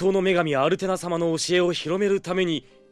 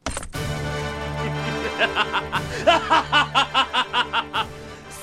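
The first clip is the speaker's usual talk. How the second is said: hearty laugh; 4 semitones higher than usual. Acted. Masked knight's grand entrance.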